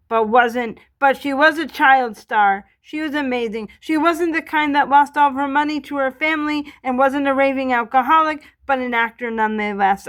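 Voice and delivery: closing announcer. Acted. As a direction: announce her story like a proud host, loud.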